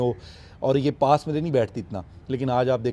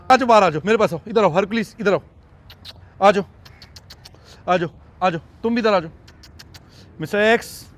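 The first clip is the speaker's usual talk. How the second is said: louder, higher voice coaxing the cub out; louder high coaxing call to the cub; 6 semitones higher, 4 dB louder than usual. Real, not acted. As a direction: call him over warmly. Calling cub out of room.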